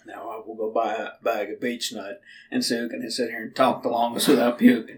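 lightly played tough guy; fake manly voice. Co-host in his fake tough voice, joking about chewing tobacco. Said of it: "the other voice is my fake voice".

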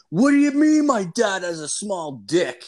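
a high, loud retold yell across the house. Yelling to his dad in the washroom, loud and high. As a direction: shout it so the dad in the bathroom hears. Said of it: "I yelled it really loud".

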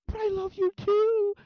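very loud, high, drawn-out squeal; lovesick bot. Retold or imitated. Bot declaring love to Junie, overemotional. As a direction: blast out a mock love declaration. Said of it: "she's, like, getting emotions".

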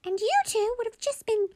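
very high squeaky lecture; exasperated squeaky mum; squeaky mummy voice. Acted. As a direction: lecture the kids while stuck in a toy voice. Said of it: "this voice of mine isn't doing anything".

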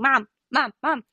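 mock toddler scream. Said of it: "in the back seat screaming".

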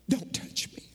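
hushed, breathy whisper of don't touch me. Acted. Jesus to Mary at the tomb, whispered. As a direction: drop to a whisper as if to Mary alone.

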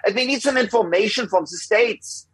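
high-pitched mock German caller; German accent. German caller asking the States for information, in a put-on German accent. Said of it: "pretending to have a German accent".

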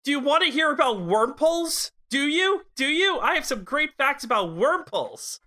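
high, excited mock know-it-all; eager fact-sharer. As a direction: pester them eagerly, imitating a trivia enthusiast. Teasing imitation of an eager fact-sharer.

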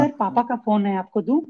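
high, polite secretary voice; man doing a woman's voice. Retold or imitated. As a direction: play a soft-spoken assistant. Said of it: "आवाज आई लड़की की".

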